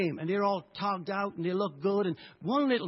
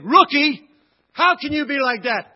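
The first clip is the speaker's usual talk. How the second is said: scornful shout, much louder and higher; mocking yell; 7 semitones higher, 14 dB louder than usual. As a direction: jeer at the rookie in contempt. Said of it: "Looking around and saying".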